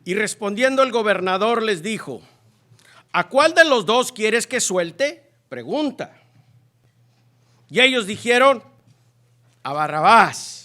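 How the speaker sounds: loud, forceful Bible reading; proclaiming scripture. A narrator's reading. Lee la Escritura a toda voz. (proclamando la Escritura con fuerza)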